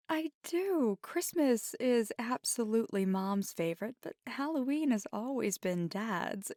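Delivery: lively, lifted, laughing delivery; playful amusement. Acted. Telling a partner about loved holidays, playful amusement. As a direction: tease fondly, with laughs breaking in.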